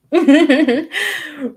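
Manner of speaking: sudden loud, high laugh at own slip; real burst of laughter at a stumble; embarrassed laugh. Real, not acted. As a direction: laugh off the flubbed word. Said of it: "I messed that word up".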